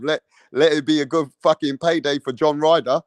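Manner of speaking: normal talking voice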